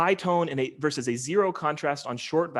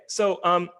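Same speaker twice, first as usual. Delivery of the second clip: ordinary talk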